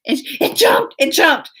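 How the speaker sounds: high, urgent insistence; mock panic. Child insisting to mom it was a flea, high mock alarm. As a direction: imitate an alarmed child reporting a bug.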